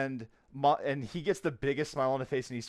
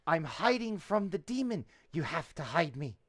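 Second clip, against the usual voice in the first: put-on high, hushed little boy plea; whisper-urgent like a kid playing hide and seek; high playful child voice; small boy; 3 semitones higher than usual. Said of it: "he looks up at you and goes".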